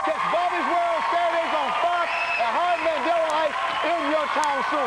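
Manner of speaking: high, loud, punchy promo read; bright hyped show-host outro; TV announcer read. Acted. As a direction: sell the break like a network announcer.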